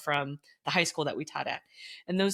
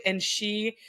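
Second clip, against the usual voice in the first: usual speaking voice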